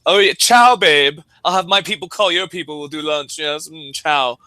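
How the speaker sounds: high, loud pretentious ciao, easing off; douchey show-off. Retold or imitated. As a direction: schmooze like a self-important big shot. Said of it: "it sounds like you're just".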